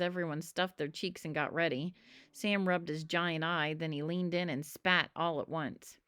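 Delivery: ordinary delivery, nothing marked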